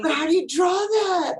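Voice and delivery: high, loud wail of helplessness; exasperated outcry. Real, not acted. Stuck novice to the group, exasperated outcry. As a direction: cry it out, voice leaping up.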